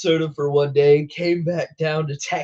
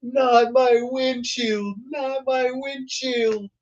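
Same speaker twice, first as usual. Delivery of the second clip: high, slow mock wail; imitating a panicked chaser; mock screaming; 10 semitones higher, slower than usual. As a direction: cry out over the windshield. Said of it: "He's, like, screaming".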